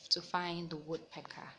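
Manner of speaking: ordinary delivery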